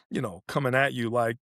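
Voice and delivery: ordinary talk, nothing marked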